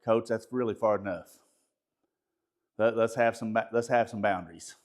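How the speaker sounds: low, softened, composed voice; de-escalate an angry coach quietly; calm boundary-setting. Shown as a demonstration. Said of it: "take a step back and go".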